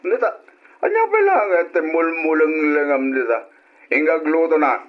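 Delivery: sharp high-pitched scolding; angry scolding. Acted. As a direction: rebuke him, pitch jumping up. Father scolds son over cooking.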